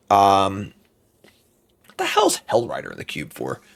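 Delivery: ordinary delivery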